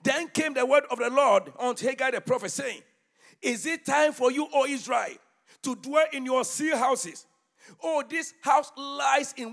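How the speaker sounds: preaching at full force. Acted. Preacher reading Haggai aloud to his church, booming. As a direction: thunder the prophet's words over the congregation.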